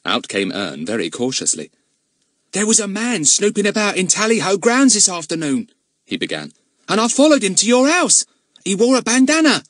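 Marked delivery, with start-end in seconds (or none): 2.5-9.6 put-on high, loud excited young lad; excited boy voice. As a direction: blurt it out like an eager boy. Narrator voices Ern.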